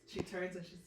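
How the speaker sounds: usual speaking voice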